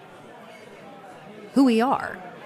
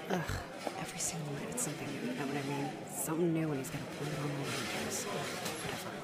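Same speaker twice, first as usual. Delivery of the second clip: muttering to herself; 14 dB quieter than usual. Acted. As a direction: complain quietly where nobody hears. Bartender grumbling to herself alone.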